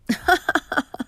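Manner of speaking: high teasing laugh at his own joke; quick high laugh after the witch joke. Acted. Joking about the witching hour.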